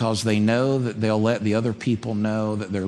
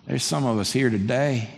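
usual speaking voice

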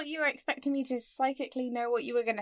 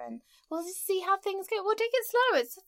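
high-pitched, overly casual let-down; mock breezy reassurance; 8 semitones higher than usual. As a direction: play it cool in a raised voice. Voices a casual friend-date brush-off.

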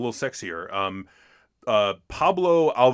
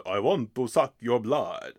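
put-on slow Dracula-style Lugosi accent; slower than usual. Podcaster quoting people's Dracula impressions, in a Lugosi accent. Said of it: "in a Lugosi accent".

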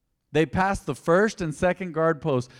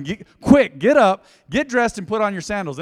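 loud, raised-pitch rousing order; urgent angel's command; 6 semitones higher, 9 dB louder than usual. Acted. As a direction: jolt him out of bed. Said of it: "The angel's telling him".

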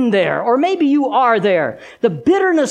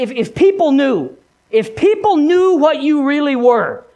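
higher-pitched, insistent accuser; accusing devil; 6 semitones higher, 4 dB louder than usual. Acted. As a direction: press the accusation home. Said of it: "he said the same thing he said to Peter".

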